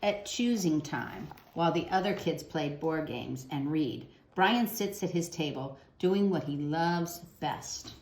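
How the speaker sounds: put-on gentle storybook narration for kids; reading to children. A narrator's reading. Teacher reading a picture book to children, gentle storytelling. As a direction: read the picture book aloud to young listeners.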